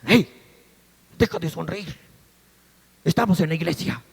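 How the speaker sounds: low, severe telling-off; stern scolding mother. Retold or imitated. Mother tells her daughter to stop smiling in church, stern. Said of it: "en un tono bien severo le dijo".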